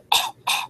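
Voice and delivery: hacking mock choke; imitated choking cough. Waking to a friend choking on vomit, imitated coughing.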